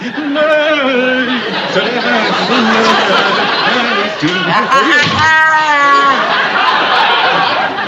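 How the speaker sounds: long loud laughing fit; secretary catching the boss dancing; loud laughter. Real, not acted.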